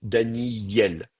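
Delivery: "Daniel" slowly as Dani-yyel; inserted y. Shown as a demonstration. Teacher illustrates the glide, drawn-out y in "Daniel". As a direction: put a long y between i and el in "Daniel". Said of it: "une sorte de Y entre les deux".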